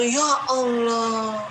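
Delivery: slow, drawn-out awed sigh of realisation; awed ya Allah. Retold or imitated. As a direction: stretch it out like a sudden revelation. Recalling her moment of realisation. (seruan takjub yang lambat dan panjang)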